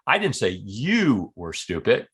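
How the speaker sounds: "you" stretched and swooping up; single out "you", meaning somebody else was stupid; stress on YOU. Shown as a demonstration. Demonstrating he meant somebody else, stress on "you". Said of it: "Was talking about somebody else".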